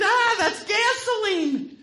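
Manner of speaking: high loud mock scream of pain. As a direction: yelp it like burning liquid hit the tongue. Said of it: "And he screamed".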